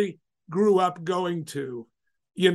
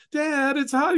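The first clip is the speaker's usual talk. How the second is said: put-on kids complaining; 8 semitones higher, slower than usual. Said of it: "they're sort of complaining".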